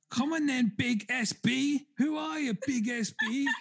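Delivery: high, slow taunting call-out; mock taunting streamer. Streamer challenging the sender on her live, taunting. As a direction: dare the sender to show themselves, up high. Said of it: "she was like".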